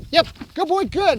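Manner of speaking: quick, high release and praise; excited dog praise. Real, not acted. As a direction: release the dog with an upbeat burst. Praising dog after release marker.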